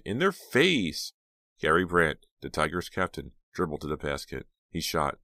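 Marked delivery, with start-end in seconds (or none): 0.1-1.1 slow, high cheering yell; cheering scream. Acted. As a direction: drag out the cheer. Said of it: "Jade screamed".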